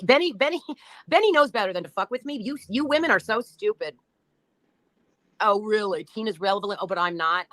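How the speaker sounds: ordinary delivery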